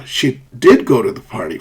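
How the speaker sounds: "did" stressed, the rest falling away; emphatic stress. Shown as a demonstration. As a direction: insist on "did". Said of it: "for emphasis".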